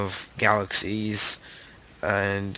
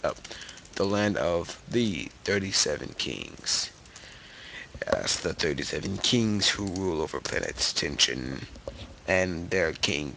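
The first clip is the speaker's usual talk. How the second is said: deep voice; 5 semitones lower, 5 dB quieter than usual. Pretend. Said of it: "with my deep voice".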